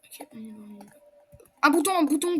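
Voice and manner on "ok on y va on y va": soft unvoiced whisper. Real, not acted. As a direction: whisper so nothing hears you. Sneaking off while hiding.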